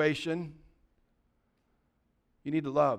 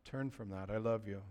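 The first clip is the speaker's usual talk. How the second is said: much softer, tender voicing of Jesus; soft, loving rebuke; gentle Jesus; 9 semitones lower, 16 dB quieter than usual. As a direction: speak it like gentle correction from Jesus. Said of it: "with gentleness and says".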